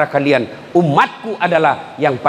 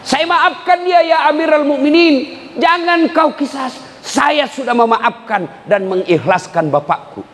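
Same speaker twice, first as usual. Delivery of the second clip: crying out; 12 semitones higher than usual. Acted. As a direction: cry out to the caliph. Young man cries out forgiveness. (seruan keras memohon)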